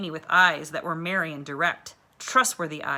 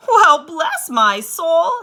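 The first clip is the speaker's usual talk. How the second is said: put-on much higher, slow, hearty old teacher; hearty exclamation; 11 semitones higher, 13 dB louder, much slower than usual. A narrator's reading. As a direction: draw it out like a delighted plump teacher. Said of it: "said the woman heartily".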